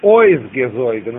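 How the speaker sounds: drawn-out Ойсгезойген, main stress on first syllable Ойс. Shown as a demonstration. Teacher showing students the accent, stress on Ойс in Ойсгезойген. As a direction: lean on Ойс at the start of Ойсгезойген. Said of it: "Ударение на ойс".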